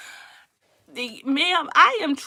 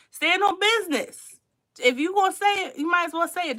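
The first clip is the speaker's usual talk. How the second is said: emphatic challenge; 7 semitones higher, 3 dB louder than usual. Retold or imitated. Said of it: "as we all know someone would say".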